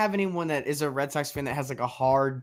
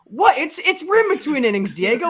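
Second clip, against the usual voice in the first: loud, high mock irritated voice; annoyed St. Louis broadcaster; 9 semitones higher, 10 dB louder, faster than usual. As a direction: imitate an annoyed broadcaster, hammed up. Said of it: "they're just like".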